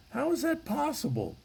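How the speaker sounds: high-pitched incredulous question; mock bafflement. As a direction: ask it way up high, feigning amazement. Mock bafflement setting up a punchline.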